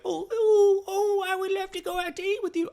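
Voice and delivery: very high, whiny squeal of fake eagerness; mock eager boyfriend. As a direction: shriek it like an overeager, desperate date. Mocking a boyfriend's eager reply.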